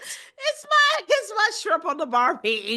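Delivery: laughing through a bad Australian accent, high at first; hammed-up Aussie voice, cracking up. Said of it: "It's so bad".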